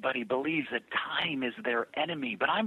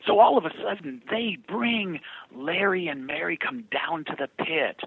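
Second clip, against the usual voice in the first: normal talking voice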